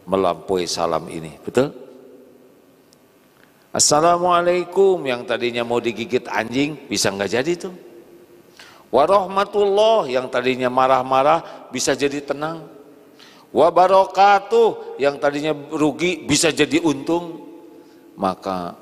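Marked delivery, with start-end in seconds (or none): 3.7-5.0 louder, higher ringing greeting; proclaimed salam. Acted. Preacher proclaims the salam to the congregation, ringing. 8.9-10.1 louder, higher ringing greeting; proclaimed salam. Acted. Preacher proclaims the salam to the congregation, ringing. 13.5-15.0 louder, higher ringing greeting; proclaimed salam. Acted. Preacher proclaims the salam to the congregation, ringing.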